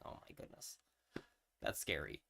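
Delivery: ordinary talk, nothing marked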